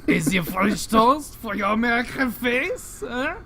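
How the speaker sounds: loud cartoon Frenchman offering food; mock French accent. Cartoon Frenchman mocking the American, loud hammed-up French accent. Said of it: "talking in a French accent".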